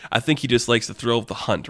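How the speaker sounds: ordinary delivery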